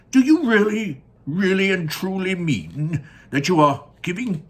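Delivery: high, loud pleading grandpa; stammering Grandpa Joe. Acted. Grandpa Joe pleading with Wonka, high and stammering. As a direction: plead in disbelief, stumbling.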